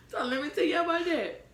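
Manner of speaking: bursting out high and loud, still laughing; loud, laughing story hook; excited. Real, not acted. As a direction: pitch it way up and loud like you can't wait to tell it.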